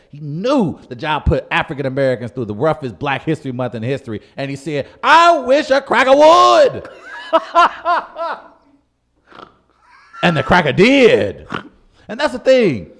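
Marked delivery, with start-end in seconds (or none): 5.0-6.9 loud, high, slow taunt; defiant dare. Retold or imitated. Jaguar daring the zookeeper, loud high taunt. 6.9-8.4 high cackle. Real, not acted. Laugh after the dare.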